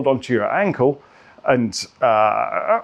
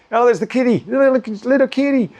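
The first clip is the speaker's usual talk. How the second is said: put-on much higher, cooing baby-talk voice; cooing at a kitty; 10 semitones higher than usual. A man passing the window gets cooed at, high baby talk. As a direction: coo it up high like greeting a cute cat. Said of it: "each time".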